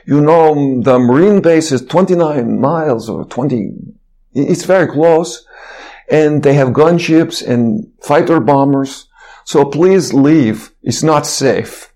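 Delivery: slow, imitated Russian-accented warning; deliberate Russian-accented plea. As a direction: urge them earnestly in a Russian accent. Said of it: "speech in his Russian accent".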